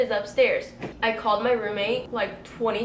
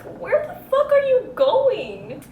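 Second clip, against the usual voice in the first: much higher, louder yell, slow; shouting; 13 semitones higher, 7 dB louder, slower than usual. Retold or imitated. Calling after her friend running down the hill, shouting. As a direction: yell after him as he runs off. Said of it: "I'm like".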